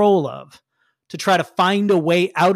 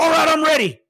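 high, loud, overeager; goofy hero shout; eager shout; 10 semitones higher, 3 dB louder than usual. Acted. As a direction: burst in ready for action. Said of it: "he's in his suit and he's like".

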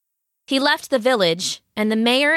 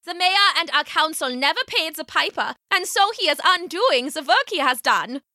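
high-pitched villager denouncing the mayor; woman crying out; 6 semitones higher than usual. A narrator's reading. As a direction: announce it to the crowd in a high, urgent voice. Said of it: "cried the woman".

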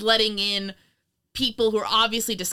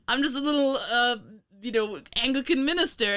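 slightly nasal disguise voice, pitched up, breaking into laughter; Basil Rathbone; 5 semitones higher than usual. Retold or imitated. Host imitating an actor disguised as a minister, slightly nasal. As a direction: imitate a meek, nasal little clergyman. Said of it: "slightly nasally voice of".

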